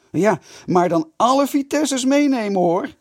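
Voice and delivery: high, loud taunting shout. A narrator's reading. Kees shouts a challenge to all Vitessers, loud. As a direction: yell the challenge across the yard. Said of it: "schreeuwde Kees".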